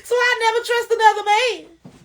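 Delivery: high, tearful mock wail; hammed-up sobbing complaint, high-pitched; mock bitter wailing. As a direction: cry it out loud like a bitter, wronged woman. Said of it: "I could have been like that".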